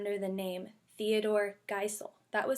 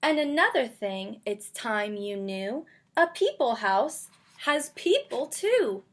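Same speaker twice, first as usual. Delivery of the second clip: lively, louder picture-book read-aloud; reading to children; 7 dB louder than usual. Acted. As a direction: read it out for a class of little kids. Teacher reads picture book aloud.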